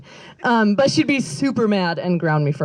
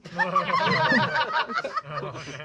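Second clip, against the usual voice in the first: long high giggle; real laugh; 7 dB quieter than usual. Real, not acted. Telling a friend's funny gift story, giggling.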